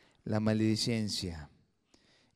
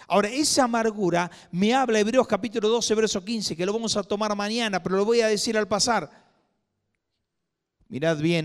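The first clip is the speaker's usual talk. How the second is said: louder, higher preaching voice; preaching at full force; 6 semitones higher, 7 dB louder than usual. Acted. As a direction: proclaim it to the whole church. Preacher raising his voice.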